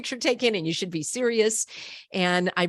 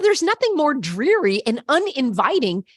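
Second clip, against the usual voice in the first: ordinary delivery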